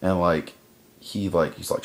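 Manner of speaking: ordinary delivery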